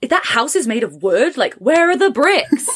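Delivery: mock puzzled English commenter; incredulous commenter. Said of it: "commenting from England being like".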